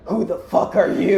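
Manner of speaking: lightly voiced indignant antihero; mock-gruff comic challenge; Shadow. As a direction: demand it in a put-on character voice. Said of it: "And Shadow's like".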